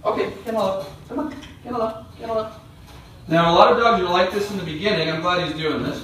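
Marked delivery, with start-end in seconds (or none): 0.0-2.5 much higher, softer coaxing call; high soft coaxing to the dog. Real, not acted. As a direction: lure the dog in with a high sweet voice.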